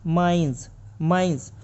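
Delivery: माइंस ending in z; माइंस with final z; correct with z. Shown as a demonstration. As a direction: say माइंस with a voiced z, minez. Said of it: "actually ये है".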